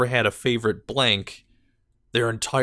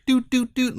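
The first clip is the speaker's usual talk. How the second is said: mock computer doots; 13 semitones higher than usual. Imitating drag and drop sounds.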